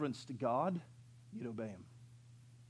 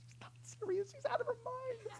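put-on very high, softer whispered-sounding kid aside; hushed kid aside; 7 dB quieter than usual. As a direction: sneak it to the manager like a scared boy. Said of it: "I was like".

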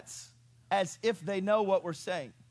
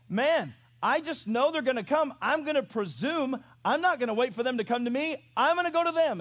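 higher, emphatic mock impatience; impatient, presumptuous; 6 semitones higher, 5 dB louder than usual. Preacher imagining Balaam's decision to go, hammed-up impatience. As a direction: play a man declaring he won't wait.

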